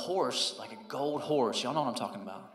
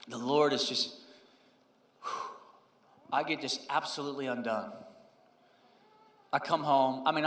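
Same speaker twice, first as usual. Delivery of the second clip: ordinary talk, nothing marked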